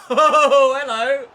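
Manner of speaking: a burst of laughter into a loud "oh, hello"; laughing surprise. Real, not acted. Gamer laughing at his screen, loud and delighted.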